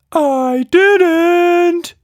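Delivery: very high, loud little creature voice; a cheeky tiny monster, full of glee; Quatch Monster voice. Acted.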